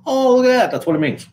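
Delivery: high, bright mock discovery on "Oh"; hammed-up surprised reader; mock surprise. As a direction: feign sudden realisation, jumping up in pitch. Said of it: "you find out".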